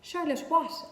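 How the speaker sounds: high, loud eager ask; eager question. Acted. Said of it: "asked Wilbur eagerly".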